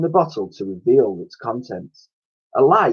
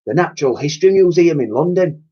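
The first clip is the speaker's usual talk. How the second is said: higher, louder cockney cleaning lady; old cleaning lady voice; 8 semitones higher, 4 dB louder than usual. A narrator's reading. Cleaner announcing where they are, chirpy and loud.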